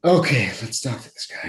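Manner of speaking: resigned, reluctant mock agreement; begrudging. Acting out the interviewer agreeing to see him, begrudging. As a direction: give in with a put-on heavy okay.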